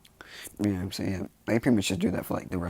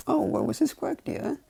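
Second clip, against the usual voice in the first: lightly voiced husband, pitched up; curious spouse; 6 dB louder than usual. Husband asking wife about son's quirk, curious. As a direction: ask it high and eager.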